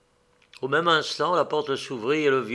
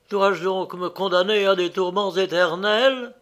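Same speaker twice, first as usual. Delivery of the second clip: usual speaking voice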